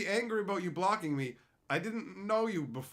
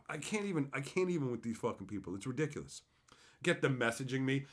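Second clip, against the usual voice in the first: usual speaking voice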